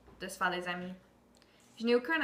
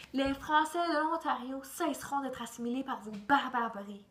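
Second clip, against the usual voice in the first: mild mock declamation, slightly raised; shouting at the top of lungs; 3 semitones higher, 4 dB louder than usual. On a pedestal before English crowds, mock proclamation. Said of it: "et crier à tue-tête".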